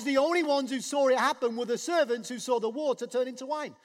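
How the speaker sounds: ordinary talk, nothing marked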